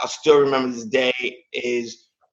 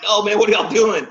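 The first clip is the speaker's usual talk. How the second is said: higher, mildly louder startled objection; alarmed protest; 7 semitones higher, 3 dB louder than usual. Retold or imitated. Husband to the staff at the curtain, alarmed protest.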